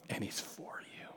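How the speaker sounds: barely voiced, tender assurance; hushed reassurance. Real, not acted. Preacher assuring newcomers that God is for them, hushed. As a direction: let it sink almost to a whisper.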